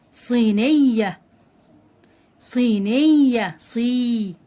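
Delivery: صينية slow and loud, stretched "seee-ney-ya", then صي alone; صينية in slow, separated teaching syllables; long-vowel demonstration. Shown as a demonstration. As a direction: hold the "ee" in صي. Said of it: "هنا مد بالإيه؟".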